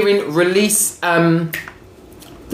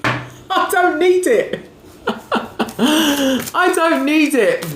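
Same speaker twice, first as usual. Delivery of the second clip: slow, much higher, laughing through the words; 8 semitones higher, much slower than usual. Real, not acted. Brushing off a stranger's bad mood to her viewers, slow and laughing. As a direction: giggle as you dismiss it, drawn out.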